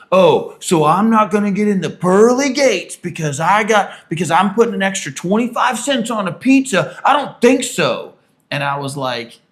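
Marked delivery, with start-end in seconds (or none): 0.1-8.1 mocking, high, loud sarcastic yelling; retold furious yell. Owner sarcastically yelling about pearly gates, furious. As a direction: imitate the owner ranting in outrage. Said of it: "he yelled at me".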